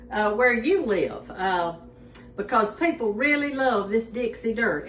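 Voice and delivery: usual speaking voice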